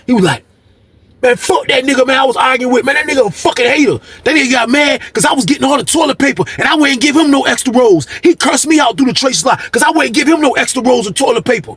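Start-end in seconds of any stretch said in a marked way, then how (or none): 1.2-11.7 angry shout. Retold or imitated. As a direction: rant it out furiously. Moose curses his rival.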